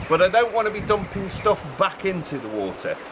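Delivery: normal talking voice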